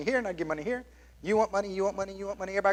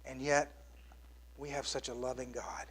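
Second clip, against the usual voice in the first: ordinary talk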